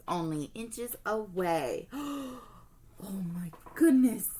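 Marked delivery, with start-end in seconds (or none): 1.9-2.4 gasp. Acted. Alukai wakes to bears. 3.0-4.3 shocked. Acted. Alukai sees bears.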